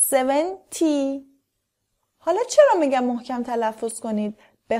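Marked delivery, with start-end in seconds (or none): 0.1-1.3 twenty forty seventy with a crisp, firm t before the y; strong final t. Shown as a demonstration. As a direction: pop the t clearly in each ty.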